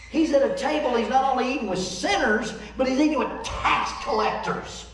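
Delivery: high, emphatic mock outrage; high-pitched, punchy indignation on their behalf; scandalized preaching. Voicing the religious crowd's outrage at sinners.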